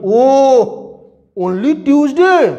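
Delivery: slow, drawn-out mock surprise; surprised. Uncle marvels that it is only Tuesday. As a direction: stretch out the disbelief.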